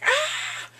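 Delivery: high, loud shriek of outrage; mock scream. Bully sees them holding hands, mock shriek. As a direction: imitate a dramatic squeal.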